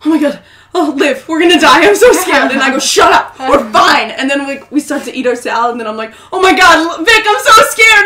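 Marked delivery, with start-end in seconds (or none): 0.0-2.5 louder, higher mock nervous fretting; acted-out panic. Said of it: "we're, like, sweating the whole time". 2.8-4.1 loud mock-irritated shutdown; retold hushing retort. Retold sisters' panicked quarrel. 6.3-8.1 loud, high hammed-up fright; acted-out panic. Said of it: "you could tell how nervous we were".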